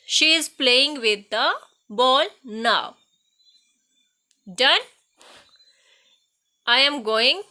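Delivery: very slow, word by word, loud and clear; slow dictation. Shown as a demonstration. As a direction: read each sentence out slowly for the class to copy. Teacher dictates example sentences. (धीमी, ज़ोरदार श्रुतलेख आवाज़)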